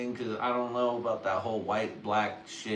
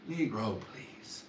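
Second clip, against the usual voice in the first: muttered dismissal; 7 dB quieter, much slower than usual. Acted. Repeating the scoff to himself, muttered dismissal. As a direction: scoff fading into a whisper.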